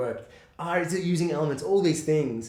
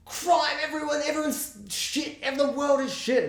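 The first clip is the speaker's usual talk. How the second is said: high-pitched mock movie rant; Joker's ranting speech; 11 semitones higher than usual. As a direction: imitate a villain's speech, pitched way up. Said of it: "when he's doing his speech".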